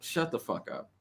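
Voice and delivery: whispered shut the fuck up, very soft; whispered retort. Retold or imitated. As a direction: hiss it back under the breath, dismissive. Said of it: "And I'm like".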